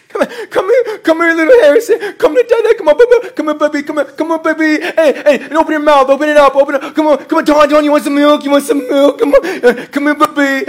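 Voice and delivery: put-on high, sing-song parent calling a toddler; playful mock baby-feeding voice; coaxing baby talk. Pastor coaxing church like babies.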